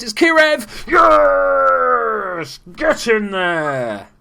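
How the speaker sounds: full-throated goal yell, drawing out get in there; goal celebration shout. Real, not acted. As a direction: roar it like your team just scored. Goal scored, commentator celebrates.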